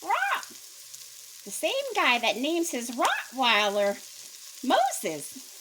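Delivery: loud, high parrot squawk. Acted. Parrot answering the burglar, loud squawk. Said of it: "squawked up and said".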